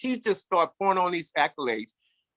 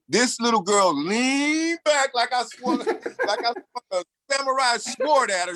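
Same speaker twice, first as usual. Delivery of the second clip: laughing while telling; 7 semitones higher than usual. Real, not acted.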